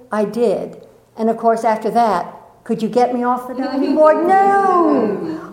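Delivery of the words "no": a high, emphatic "No!"; gleeful no. Retold or imitated. Recalling the diving board, a gleeful high refusal. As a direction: refuse with childish delight.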